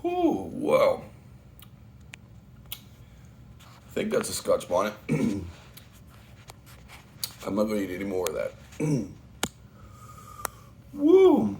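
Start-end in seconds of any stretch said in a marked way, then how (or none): 0.0-1.0 very high whew, then wow; pained high whew. Real, not acted. Reacting to a hot pepper. 10.9-11.6 loud high woo from the burn. Real, not acted. Reacting to a hot pepper.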